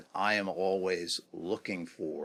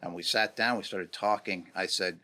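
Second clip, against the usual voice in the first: ordinary talk, nothing marked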